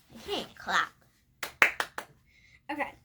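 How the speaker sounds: ordinary delivery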